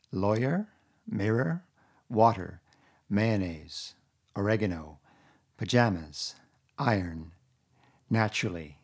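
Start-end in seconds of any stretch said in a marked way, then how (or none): none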